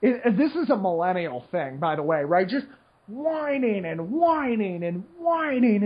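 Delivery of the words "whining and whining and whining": slow, lilting stretch of whining; drawn-out mock whining. Mocking whiny Facebook posts, drawn out slowly. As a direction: drag the word whining out mockingly.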